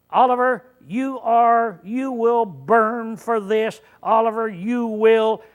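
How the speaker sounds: preacher cursing Oliver by way of example, loud and slow; mock curse. Shown as a demonstration. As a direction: call down doom on him, hammed up. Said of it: "if I curse Oliver".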